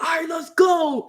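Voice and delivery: high re-enacted combat rallying shout; fired-up call. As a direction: shout it high as if charging into battle. Retelling feeling like in combat.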